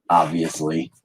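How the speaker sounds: normal talking voice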